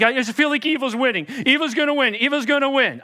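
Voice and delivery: anxious believer fretting aloud; worried complaining. Retold or imitated. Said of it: "You're like".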